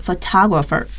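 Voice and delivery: "photographer" stressed on the second syllable, pho-TOG-ra-pher. Shown as a demonstration. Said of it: "它的重音呢在后面，T的位置".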